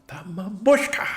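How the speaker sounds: high, slow, drawn-out theatrical cry; movie-quote exclamation. Acted. Gamer quotes Addams Family movie, high theatrical announcement. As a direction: announce the mamushka with a drawn-out, rising flourish.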